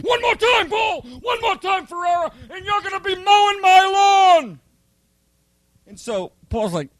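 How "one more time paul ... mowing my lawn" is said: much higher, strained mock yell at a recruit; screaming drill instructor. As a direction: squeal the threat like a furious sergeant. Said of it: "He goes".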